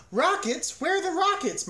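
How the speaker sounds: very high, loud, eager query; excited question. A narrator's reading. Cow mishears Rockettes as rockets, asking excitedly. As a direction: ask it with squealing excitement.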